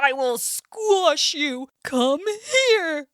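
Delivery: furious bear. Acted. Bear swinging his stick at the bee, furious. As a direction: chase the bee in a rage. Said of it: "gets angrier and angrier".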